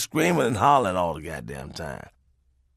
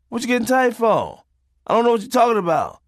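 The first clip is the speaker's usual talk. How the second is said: put-on teasing kid voice; 9 semitones higher than usual.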